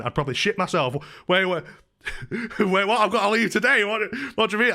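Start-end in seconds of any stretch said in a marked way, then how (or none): 1.3-4.7 mock panic. Imagining a clueless tenant told to leave today, mock panicked. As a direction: play someone panicking at sudden news. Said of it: "didn't realise what evictions meant".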